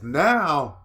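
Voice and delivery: high, loud, slow indignant build; you just realized you were played; worked-up outrage. Real, not acted.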